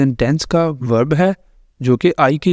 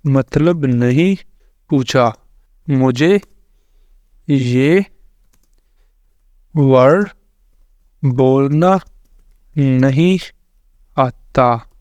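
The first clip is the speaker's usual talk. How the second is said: slow dictation; much slower than usual. Real, not acted. Retyping prompt word by word.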